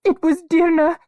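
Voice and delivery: high, loud distressed cry; girl crying to her nurse. A narrator's reading. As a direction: cry it out, upset. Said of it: "cried Ariel".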